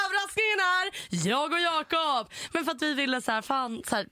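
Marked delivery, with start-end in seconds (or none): 0.1-2.3 high, slow proud reveal; a proudly announced surprise, lightly hammed up; mock announcement.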